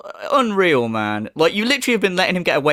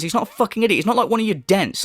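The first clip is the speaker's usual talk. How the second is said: ordinary talk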